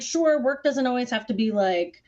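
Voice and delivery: ordinary delivery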